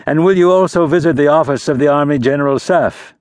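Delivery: sly aristocratic question; prince. A narrator's reading. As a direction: prince probing the baron's plans. Prince voiced higher.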